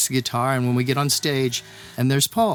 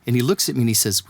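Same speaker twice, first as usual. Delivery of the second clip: usual speaking voice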